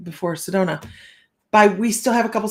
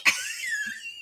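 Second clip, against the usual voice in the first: a high, shrieking burst of laughter; high squealing laugh; 6 dB quieter than usual. Real, not acted. To her viewers, a high delighted squeal of laughter. Laughs at the desk clerk's reaction.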